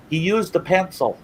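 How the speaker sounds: high-pitched call from a car window; mock yell. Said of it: "I yelled it out the car window".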